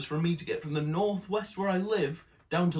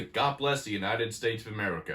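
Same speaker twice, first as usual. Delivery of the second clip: put-on American accent, lower pitch; 5 semitones lower than usual. Politicians campaigning on religion, in a put-on American accent. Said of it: "That's my American accent".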